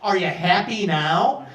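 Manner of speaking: slow, loud sarcastic jab at the traveler. Retold or imitated. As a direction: drag it out with mock sarcasm. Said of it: "real sarcastically".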